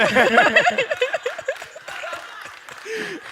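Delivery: long, high, loud laughing fit; helpless laughter. Real, not acted. Storyteller losing it over the actor's song gag, helpless laughter. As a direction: crack up completely, high and loud.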